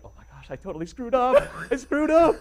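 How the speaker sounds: high panicked inner thought; rising panic at his own blunder. Retold or imitated. Mid-interview realising he screwed up, high panicky voice. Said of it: "I was like".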